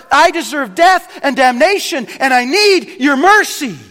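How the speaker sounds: loud, high plea for mercy; earnest confession. Retold or imitated. Preacher voicing the humble plea for mercy. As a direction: confess it with urgency.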